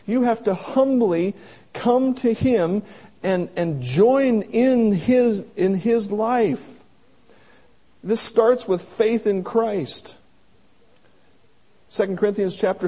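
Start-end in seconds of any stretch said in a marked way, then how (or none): 0.1-10.1 slow, raised, louder preaching build; fervent preaching. Acted. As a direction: drive the appeal home, slow and strong. Preaching climax on humility.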